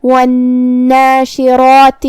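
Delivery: slow chant, full dark "raa" in والناشرات; heavy ra. Shown as a demonstration. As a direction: give والناشرات a long nn and a heavy, rounded long ra. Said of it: "دونوں کو بھاری آواز میں ادا".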